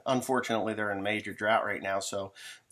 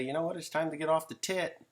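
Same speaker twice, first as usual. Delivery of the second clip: higher, lecturing parent voice; mock stern parents; 6 semitones higher than usual. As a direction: scold the grown kid like a fed-up parent. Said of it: "mom and dad say".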